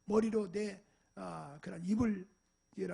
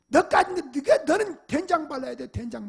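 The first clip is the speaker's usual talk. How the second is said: higher, louder mock threat to the dog; angry threat; 5 semitones higher, 10 dB louder than usual. 짖은 개를 혼내며 반쯤 농담으로 위협하는 화난 외침. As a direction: threaten the dog in a rage, half joking.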